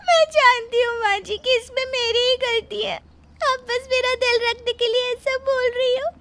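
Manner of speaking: high, tearful pleading through sobs; crying. Acted. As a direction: plead with mother-in-law while crying. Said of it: "Surabhi रो रही है".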